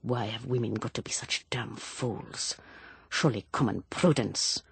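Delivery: whispered mutter, half to himself; muttered under breath. A narrator's reading. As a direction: grumble it under your breath, barely voiced. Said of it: "He muttered under his breath".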